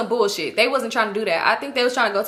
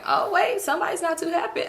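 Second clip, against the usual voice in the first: high, teasing sing-song; 8 semitones higher, 3 dB quieter than usual. Pretend. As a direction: tease her playfully. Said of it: "I'm just kidding".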